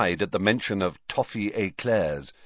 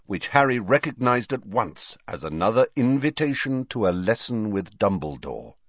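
ordinary delivery, nothing marked

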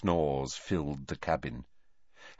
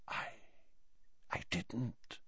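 very soft, halting and shocked; hushed voice; 4 semitones higher, 17 dB quieter than usual. A narrator's reading. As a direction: falter it out under your breath. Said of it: "in a hushed voice".

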